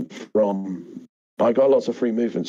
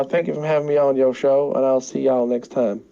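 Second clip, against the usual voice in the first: higher-pitched Southern sign-off; Southern accent; 4 semitones higher than usual. Acted. Guest thanking the host for having him on, Southern accent. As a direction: slip back into a Georgia accent.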